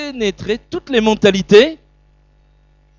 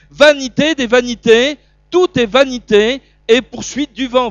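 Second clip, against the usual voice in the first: loud, raised preaching proclamation; proclaiming loudly; 5 semitones higher, 8 dB louder than usual. Acted. Preacher proclaiming Solomon's words to the congregation, loudly. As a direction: thunder it out from the pulpit. Preacher quoting Ecclesiastes with force.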